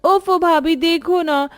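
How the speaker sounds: high, whiny coaxing; wheedling nanad. Acted. Nanad coaxing bhabhi for rain pakoras, high and whiny. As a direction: beg sweetly for pakoras.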